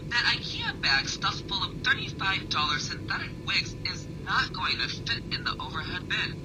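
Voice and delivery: ordinary delivery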